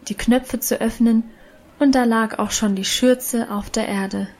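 plain reading voice

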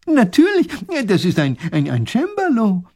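high, loud, stammering enthusiast; flustered, delighted expert voice; excitable professor, eager. Acted. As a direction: burst out, then stumble over the word.

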